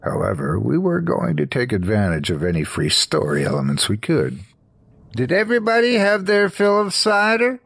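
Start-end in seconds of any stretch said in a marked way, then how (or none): 5.2-7.6 very high-pitched character voice; prankster friend. A narrator's reading. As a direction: ask it high, in the sidekick's voice.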